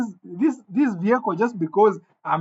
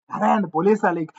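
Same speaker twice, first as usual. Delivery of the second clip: ordinary delivery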